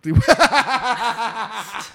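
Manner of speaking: high, sustained giggling laugh; helpless high laughing fit; burst of laughter. Real, not acted. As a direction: crack up at the joke. Laughs at cousin joke.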